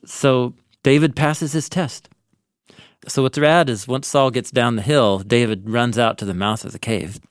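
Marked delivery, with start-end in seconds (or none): none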